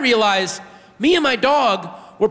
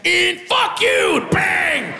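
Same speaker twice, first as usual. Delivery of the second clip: higher, shouted New York aggression; street yelling; 12 semitones higher, much slower than usual. Retold or imitated. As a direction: bark it like an angry passer-by. New York street noise assault.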